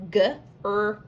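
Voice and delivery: usual speaking voice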